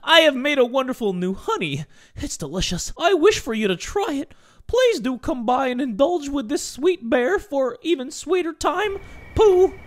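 high, put-on letter-reading voice; off-model Pooh accent. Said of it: "I don't even know whose accent that was".

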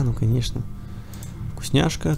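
normal talking voice

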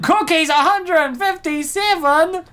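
loud, very high shriek of disbelief; shocked outcry. Acted. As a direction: shriek the figure in hammed-up shock. Shocked at the cookie count.

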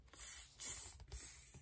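very soft hissed monkey tss. A narrator's reading. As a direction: tease back like a cheeky monkey.

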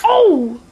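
high, loud yelp; loud cry of alarm. Acted.

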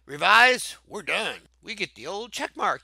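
ordinary delivery

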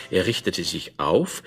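usual speaking voice

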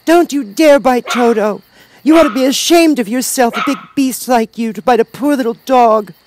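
put-on loud, high-pitched scolding girl; indignant cry. A narrator's reading. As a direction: scold the beast at full voice, as a child. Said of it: "while she cried out".

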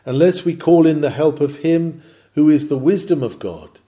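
ordinary talk, nothing marked

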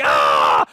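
low, loud angry shout; loud exasperated yell; mock frustrated yell. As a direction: let out a big frustrated roar. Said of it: "I was just like".

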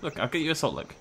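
ordinary delivery

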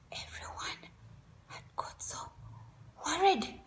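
hushed whisper. A narrator's reading. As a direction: whisper it like a secret. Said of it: "hushed, worried storytelling".

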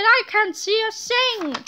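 small and muffled in a pocket; tiny voice. A narrator's reading. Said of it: "cried Tiny Tippy".

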